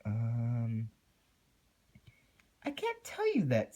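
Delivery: very high, jumpy pony voice; creepy Pinkie. A narrator's reading. Pinkie teasing Twilight then catching herself, very high and jittery. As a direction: giggle off the slip-up nervously.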